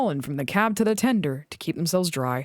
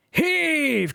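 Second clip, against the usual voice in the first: called; 8 semitones higher than usual. A narrator's reading. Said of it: "called the Fat Controller".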